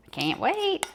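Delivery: ordinary delivery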